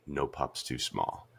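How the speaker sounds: low, slow and soft retold catchphrase; quiet resolve. Retelling the heroine facing the giant meteor, quiet resolve. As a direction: say it slowly to yourself, low and hushed.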